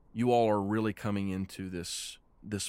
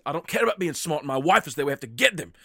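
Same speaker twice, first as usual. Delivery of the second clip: louder, higher furious insistence; angry outburst; 7 semitones higher, 7 dB louder than usual. Acted. Husband yelling at Fang that his wife is there, angry shout. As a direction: shout him down, you won't wait.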